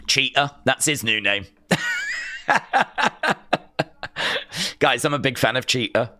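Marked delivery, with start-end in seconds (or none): 1.7-3.6 squeaky high-pitched laugh; real high laugh. Real, not acted. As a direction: burst into a falsetto giggle. Laugh after Cheetah joke.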